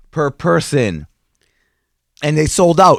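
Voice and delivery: ordinary delivery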